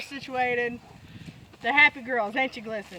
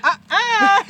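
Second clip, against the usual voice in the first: high, loud whiny uh-uh; mock cow refusal; 7 semitones higher, 7 dB louder than usual. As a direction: whine a cow's refusal. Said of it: "and the cows went".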